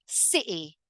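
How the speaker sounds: "City" as "Ci'y"; glottal T. Shown as a demonstration. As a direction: close the throat for a moment instead of the T in "City". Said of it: "your vocal cords actually close up".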